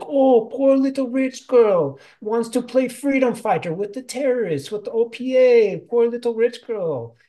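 high, loud taunting sneer; sneering mockery. Jala sneering at May, mocking. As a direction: belittle her with scorn.

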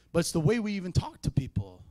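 ordinary delivery

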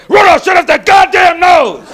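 loud, high angry shout; Santa yelling. Retold or imitated. Santa yelling at Rudolph about his blinking nose, loud shout. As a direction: bellow at him. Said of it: "It's like".

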